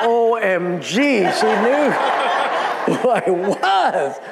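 high, drawn-out stunned words; mock shock. Preacher realising she knew who he was, mock shocked. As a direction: stretch each word in comic disbelief. Comic shock at being recognised.

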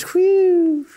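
a high, loud sigh of relief; passengers' huge relieved whew. Retold or imitated. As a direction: blow it out like you just survived. Relief after plane recovers.

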